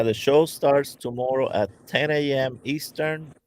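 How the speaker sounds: ordinary talk, nothing marked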